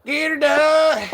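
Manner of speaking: slow exaggerated good-old-boy shout; Larry catchphrase. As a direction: belt the catchphrase like the comedian himself. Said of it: "Now we go and say".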